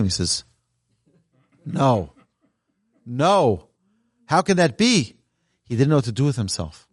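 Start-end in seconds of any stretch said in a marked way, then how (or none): 1.6-5.1 slow no, no, then a higher stunned question; dawning horror at his mistake; stunned disbelief. Retold or imitated. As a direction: passenger realizing who he insulted. Passenger realizes his mistake.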